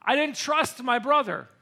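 ordinary delivery

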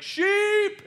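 high, loud call across a field; shepherd shouting for his sheep. Retold or imitated. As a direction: call out to the flock.

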